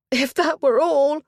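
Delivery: high, loud, slow lament; as if the worst is yet untold; a distraught lady crying out, high and slow; despairing. Acted. Duenna despairs.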